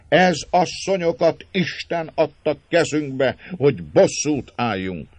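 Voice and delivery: magas, hangos, bosszúra szomjazó kitörés; dühödt szenvedély. A narrator's reading. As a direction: fokozd a szenvedélyt szinte dühösségig. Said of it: "csak nem dühösségig növekedő szenvedéllyel". (dühösségig fokozódó, szenvedélyes hangon)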